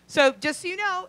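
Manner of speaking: normal talking voice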